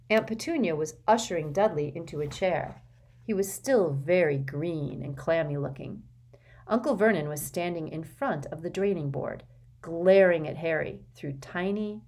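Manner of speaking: ordinary delivery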